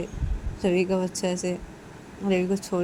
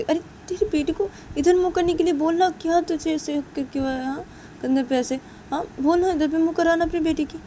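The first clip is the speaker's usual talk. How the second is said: high, loud instructions to her brother; a sister ordering how to hold the baby, higher; teasing, bossy sister voice; bossy older sister; 10 semitones higher, 5 dB louder than usual. Acted. Siya bossing Ravi about baby.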